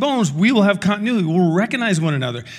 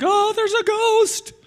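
much higher, slow hammed-up fright; squealing mock terror at a ghost; mock panic; slower than usual. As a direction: play a spooked disciple seeing a ghost. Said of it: "The disciples are freaking out".

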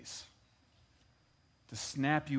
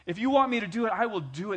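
surrendering prayer; 8 semitones higher, 10 dB louder than usual. Acted. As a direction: give in to God's will, crying out. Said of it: "That's what Jesus was saying".

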